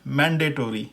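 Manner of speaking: मैंडेटरी as man-day-tor-ee; American pronunciation. Shown as a demonstration. Teacher repeats for learners, American pronunciation. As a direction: sound the o in मैंडेटरी. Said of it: "American pronunciation में".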